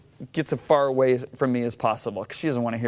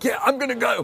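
high, eager yes to a game ticket; imitating his own keen younger self; 11 semitones higher than usual. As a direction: jump at the offer, pitch way up. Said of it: "I'm like".

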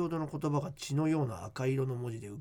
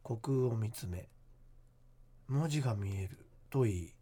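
hushed creepy ghost story; 3 semitones lower than usual. Acted. Reads listener ghost letter.

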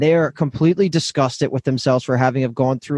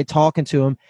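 ordinary delivery

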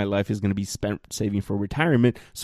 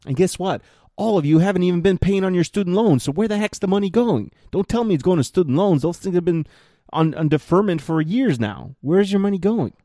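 higher and louder, heated, scolding the listeners; worked up rant; 8 semitones higher, 3 dB louder than usual. Real, not acted. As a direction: climb up into an exasperated scolding. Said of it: "I'm getting worked up here".

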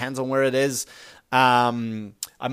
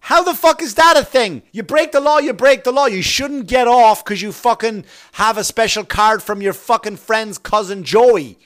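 much higher, loud furious ranting; angry rant; 6 semitones higher, 7 dB louder than usual. Real, not acted. Ranting to listeners about cops letting friends off, furious. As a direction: shout down the unfairness of it.

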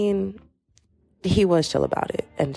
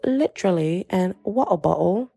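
British accent; 2 semitones higher than usual. Shown as a demonstration. Podcaster shows off her two words, fake British accent. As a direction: put on a playful British lilt, pitched up. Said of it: "could say in a British accent".

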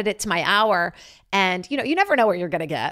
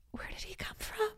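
hushed, breathless whisper; 16 dB quieter than usual. Retold or imitated. Comic wondering aloud where the man vanished, whispered. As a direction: whisper it like you saw a ghost.

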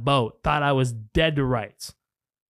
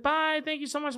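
sweet goodbye; 11 semitones higher, 6 dB quieter than usual. Retold or imitated. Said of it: "Everybody's like".

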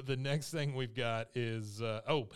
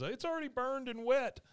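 put-on much higher, squeaky kid reply; whiny boy voice; 13 semitones higher, 4 dB louder than usual. Bobby answering his mom from the stage, high squeaky kid voice. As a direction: pitch way up like a deflated kid. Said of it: "He goes".

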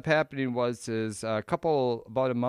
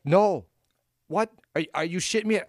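retold shock; 8 semitones higher, 4 dB louder than usual. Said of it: "She's like".

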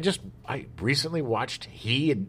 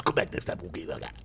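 whispered; 9 semitones lower, 9 dB quieter than usual. Acted. Sign-off after Fonz bit.

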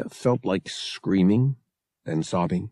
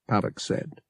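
ordinary delivery

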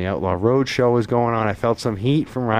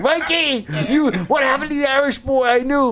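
high put-on voice imitating his brother; mock his brother's teasing, pitched way up; imitating his late brother's ribbing, high and teasing; 13 semitones higher than usual. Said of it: "and he'd be like".

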